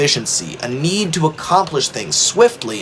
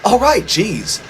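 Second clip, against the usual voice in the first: huffy Thomas; 7 semitones higher than usual. A narrator's reading. Offended Thomas.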